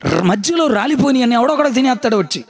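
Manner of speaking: ordinary talk, nothing marked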